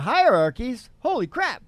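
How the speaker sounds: ordinary delivery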